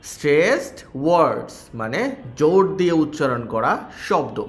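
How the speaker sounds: usual speaking voice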